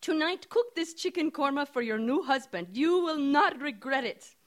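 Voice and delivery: put-on high, loud insistent Indian accent. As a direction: urge her firmly in an Indian accent. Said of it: "she says in her strong Indian accent".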